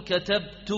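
"كتبت" said katabtu, ending in oo; final -tu. Shown as a demonstration. Teacher lists verb endings for class, "كتبت" as katabtu. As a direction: close "كتبت" on a clear -tu. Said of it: "وممكن تقول".

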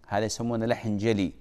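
ordinary talk

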